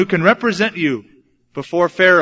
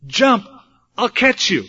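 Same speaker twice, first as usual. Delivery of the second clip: a higher, louder urging dad; 6 semitones higher, 3 dB louder than usual. Retold or imitated. Father in the pool urges his son again, higher. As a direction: coax him in, rising. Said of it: "my dad says".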